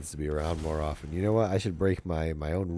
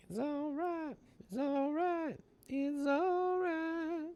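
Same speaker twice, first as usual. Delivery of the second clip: very high, slow falsetto "It's all right"; sing-song it in a tiny high voice, drawn out; falsetto reassurance; much slower than usual. Acted.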